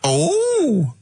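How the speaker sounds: high, swooping mock delight; exaggerated comic character introduction; hammed-up sketch voice.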